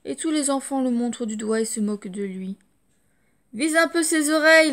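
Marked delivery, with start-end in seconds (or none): 3.5-4.7 high, loud jeering taunt; loud schoolyard mockery; mocking kid. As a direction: tease him in front of everyone. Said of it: "lance un gamin".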